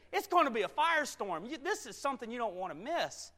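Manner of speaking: high, loud, excited hype; scorpion's hard sell. Acted. Scorpion tempts the frog with a party, loud excited pitch.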